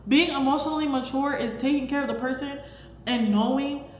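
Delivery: ordinary talk, nothing marked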